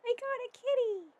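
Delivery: high and gleeful; delighted. Real, not acted. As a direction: keep the delighted squeal. Repeats delighted.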